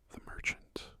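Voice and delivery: ordinary delivery, nothing marked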